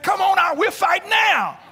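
high, loud taunting call; mock challenge shout. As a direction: shout it like a brash boxer at the door. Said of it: "and said".

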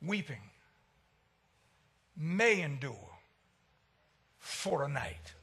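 tender comfort. Real, not acted. Preacher gently quoting that joy comes in the morning. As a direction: comfort them one word at a time.

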